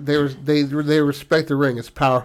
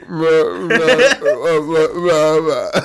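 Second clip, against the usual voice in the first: Bruce Willis voice; 3 semitones higher, 5 dB louder, much slower than usual. Retold or imitated. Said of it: "you have to have Bruce Willis's voice".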